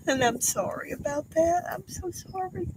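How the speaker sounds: apologizing while the tears win; crying apology. Real, not acted. Widow apologizing to viewers for crying, high and tearful. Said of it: "I don't mean to cry".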